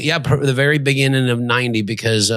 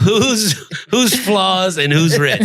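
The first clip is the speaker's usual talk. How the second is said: laughing; 8 semitones higher, much slower than usual. Real, not acted. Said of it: "we're playing a game with the audience".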